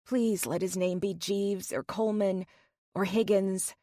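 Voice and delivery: plain reading voice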